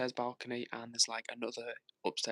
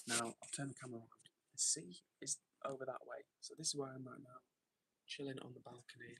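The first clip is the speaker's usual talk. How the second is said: hushed, softer voice; quiet but clear; 10 dB quieter than usual. Real, not acted. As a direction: keep it down for the neighbours. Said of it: "I'm speaking quietly tonight because I'm actually outside".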